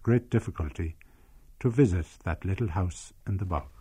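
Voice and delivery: measured storytelling read; story narration. A narrator's reading. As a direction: tell the village tale evenly, like a documentary. Documentary narration of memoir.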